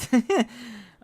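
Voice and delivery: sudden high, loud laugh at herself; burst of laughter. Real, not acted. Streamer laughing at her own mangled German, high burst of laughter. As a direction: crack up after botching the German.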